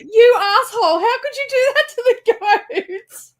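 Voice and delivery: high, loud outraged cry, laughing through it; mock outraged yell. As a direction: scold him with mock fury, voice cracking high. Yelling at husband about goats.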